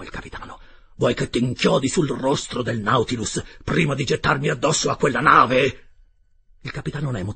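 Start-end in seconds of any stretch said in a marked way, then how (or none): 1.0-5.8 loud threat building to a full roar; furious roar. A narrator's reading. Captain threatens the Canadian he has just felled, roaring. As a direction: roar it louder and louder, menacingly. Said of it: "non parlava più, ruggiva".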